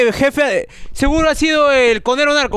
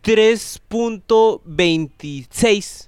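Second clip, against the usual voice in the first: very slow, spaced-out number; mocking slow read; much slower than usual. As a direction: drag out each part of the figure. Lee una cifra rara, burlándose. (cifra leída lentísima, con pausas)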